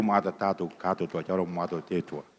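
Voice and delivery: normal talking voice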